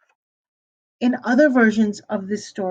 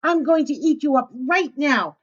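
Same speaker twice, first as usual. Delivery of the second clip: angry wolf; 6 semitones higher than usual. A narrator's reading. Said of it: "he cried".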